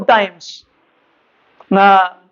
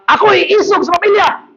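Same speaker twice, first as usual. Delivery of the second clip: high-pitched comic bragging; mock boastful husband; 10 semitones higher than usual. Pastor's joke about a brave husband, mock boasting. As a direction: imitate a husband boasting in a raised voice. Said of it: "I hear pastors saying".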